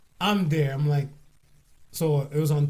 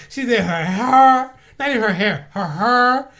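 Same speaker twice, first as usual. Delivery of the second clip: excited; 5 semitones higher, 8 dB louder, slower than usual. Real, not acted. Excited about her look.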